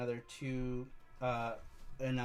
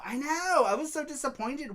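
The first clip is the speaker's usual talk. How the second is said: excited agreement; 12 semitones higher, 5 dB louder than usual. Real, not acted. Agreeing with a chatter.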